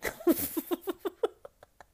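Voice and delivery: high-pitched burst of laughter; real laugh. Real, not acted. Laughing at their own blunt advice, high giggle.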